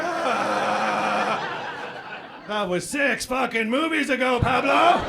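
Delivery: acted fed-up father, building to a yell; exasperated yell. Papa answers Pablo about food truck, exasperated yelling.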